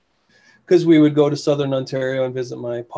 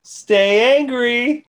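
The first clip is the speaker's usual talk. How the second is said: slow, drawn-out, higher sign-off; 9 semitones higher, 4 dB louder, much slower than usual. Shown as a demonstration. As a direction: stretch the sign-off slow and high. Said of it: "and then you finish".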